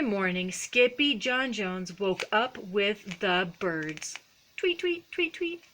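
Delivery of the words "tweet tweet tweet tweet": tiny high tweets; bird chirps. A narrator's reading. Narrator voices the birds Skippy woke up with, high chirping.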